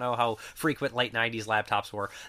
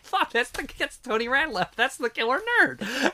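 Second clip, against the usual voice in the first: high-pitched retold burst of realization; excited recognition; 11 semitones higher than usual. Friend recognizing a voice actor at a convention, excited. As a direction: act out suddenly spotting who it is.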